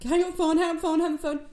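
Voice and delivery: loud, high, frantic repeats; panicked yelling. Acted. Rachel jumping on Ross's back for the phone, frantic yell. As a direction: shout it in a mock panic.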